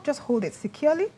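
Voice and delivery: ordinary delivery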